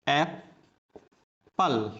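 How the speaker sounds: "एप्पल" split into ap, long pause, pal; syllable by syllable. Shown as a demonstration. Teacher showing how it is pronounced, "एप्पल" broken as ap - pal. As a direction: say "एप्पल" very slowly in two separate parts. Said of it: "तो इसका उच्चारण क्या है?".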